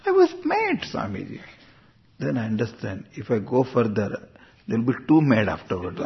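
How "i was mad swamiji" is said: very high squeal on the complaint, dropping on the name; hammed-up high-pitched insistence; mock exasperated protest. Exasperated devotee insisting to Swamiji, mock protest.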